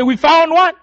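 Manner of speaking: loud, high call to the others; shout. Retold or imitated. Killer calling the others to the roadblock, loud and high. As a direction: yell out the find. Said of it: "And he shouts".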